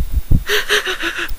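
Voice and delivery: high squealing laugh breaking through; can't hold back the giggles; real laughter. Real, not acted. Reader losing it at the crate line, high helpless laughter.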